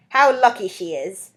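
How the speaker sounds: slow, loud, a hint of a cackling crone; mutter-envy like a toothless old woman; lightly voiced envious old hag. Toothless old hag envies Fantine, slow and loud. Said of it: "toothless old hag".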